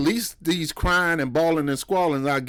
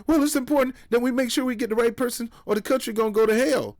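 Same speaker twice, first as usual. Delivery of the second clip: 1 mock anxious voter; 7 semitones higher, faster than usual. Mocking a worried voter.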